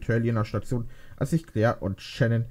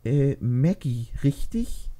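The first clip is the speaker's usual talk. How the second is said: unsure question; 4 semitones higher, much slower than usual. Acted. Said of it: "fragt Judy unsicher".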